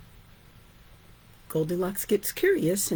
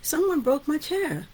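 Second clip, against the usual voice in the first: mock crying; 8 semitones higher than usual. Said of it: "he keeps continuing to cry".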